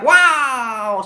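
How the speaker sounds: high, loud cry of shock; mock scream, pitched well up; imitated startled scream. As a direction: mimic passengers yelling as the plane drops. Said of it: "orang teriak".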